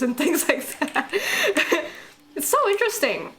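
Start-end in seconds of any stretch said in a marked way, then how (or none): 1.2-1.9 short, high burst of laughter; real laugh. Real, not acted. Fan recalling a host's divorce remark, amused laugh. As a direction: laugh suddenly, high and brief.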